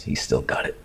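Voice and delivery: whispered teasing aside. Real, not acted. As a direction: breathe it as a secret joke.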